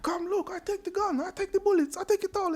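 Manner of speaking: mock Tony boast. Tony boasting he takes every bullet, very high.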